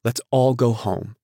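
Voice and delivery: helpless, imploring appeal; helpless plea. A narrator's reading. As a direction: beg helplessly, stretching the words. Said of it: "she interrupted helplessly".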